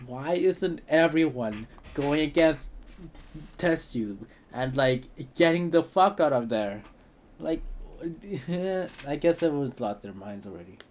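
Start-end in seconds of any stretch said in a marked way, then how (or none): 0.1-6.8 panicked shout. Acted. 7.7-9.0 laugh. Acted. Burst of laughter.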